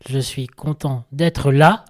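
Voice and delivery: slow and loud, the word là rising at the close; third version, accent on là. Shown as a demonstration. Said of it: "Ou alors, troisième version".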